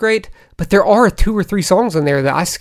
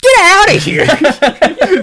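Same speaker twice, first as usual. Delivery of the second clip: high, drawn-out get outta here; outraged disbelief; 12 semitones higher, 5 dB louder, much slower than usual. Real, not acted. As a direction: yell it in shocked disbelief. Outraged at rival pick.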